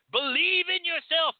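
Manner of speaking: pep them up like a mock life coach. Flattering client to reader: high, hammed-up coach cheer. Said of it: "become your fucking coaches and be like".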